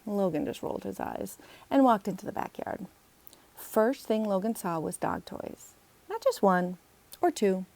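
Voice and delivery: ordinary delivery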